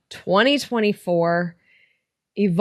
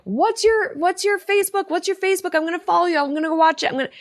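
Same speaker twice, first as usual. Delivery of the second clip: high, eager fan voice asking for her Facebook; imitating gushing fans, much higher; excited fans asking; 10 semitones higher, faster than usual. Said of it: "people are like".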